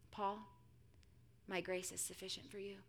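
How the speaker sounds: hushed, measured reassurance; quiet, tender authority; gentle divine answer. Acted. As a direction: speak softly and low, leaving space between phrases. Voicing God's gentle answer to Paul.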